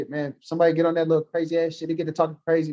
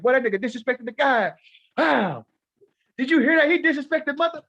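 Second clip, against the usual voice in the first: mock outrage; 9 semitones higher than usual.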